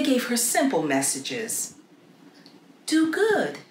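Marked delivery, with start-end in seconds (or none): 2.9-3.6 lifted high and light, like a voice from heaven; a gentle heavenly messenger; angelic voice. A narrator's reading. Angels to young Joan, an airy heavenly voice.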